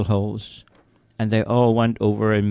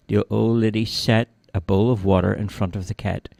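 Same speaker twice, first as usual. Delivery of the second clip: ordinary delivery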